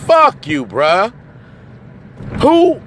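ordinary talk

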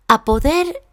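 usual speaking voice

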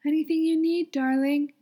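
high, loud call across the garden; calling out to Crowley in the garden, high and loud. Acted. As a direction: call out sweetly from the kitchen. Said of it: "Aziraphale calls out".

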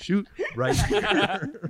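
slow, high dare with a laugh; slow mock dare. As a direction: dare them slowly, laughing. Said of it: "He's like".

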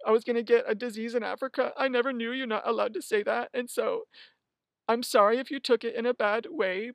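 high, breezy insincere YouTuber apology; mock a fake sorry-not-sorry apology. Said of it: "the apology that's like".

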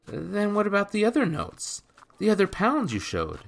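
a startled lad blurting out; young boy Jacques. A narrator's reading. Jacques voiced.

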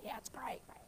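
lost voice, only a breathy whisper left; hoarse whisper. Shown as a demonstration. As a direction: whisper it like your voice is gone from shouting. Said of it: "that's how I was actually doing".

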